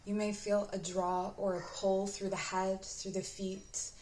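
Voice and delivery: unhurried, even-toned meditation guide; guided meditation. Acted. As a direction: guide the listener gently inward, leaving space to breathe.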